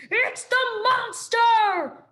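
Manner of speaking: high, loud, stretched shriek; terrified screech. A narrator's reading. Owl shrieking at the robot in terror. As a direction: screech in panic.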